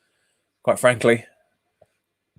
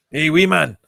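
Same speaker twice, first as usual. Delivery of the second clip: mock Scottish greeting, lightly done; Scottish accent; 3 semitones higher than usual. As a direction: play a cheeky Glaswegian calling to a mate. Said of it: "It's not Irish".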